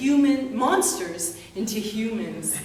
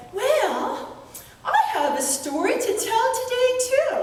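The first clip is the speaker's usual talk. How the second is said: put-on much higher, quick, teasing girl voice; little sister. Girl hinting at her secret to her brothers, high and teasing. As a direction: tease the brothers in a high bright child voice.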